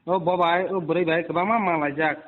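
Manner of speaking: ordinary talk, nothing marked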